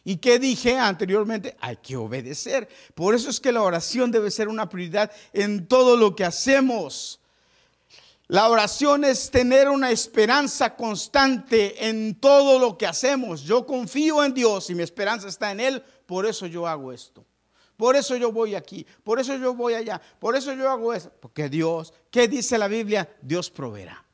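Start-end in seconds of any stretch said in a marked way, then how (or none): 3.0-15.5 loud, high preaching, stressing todo; preaching at full force. Acted. Preacher urging the congregation, loud and high. 17.8-21.1 loud, high preaching, stressing todo; preaching at full force. Acted. Preacher urging the congregation, loud and high.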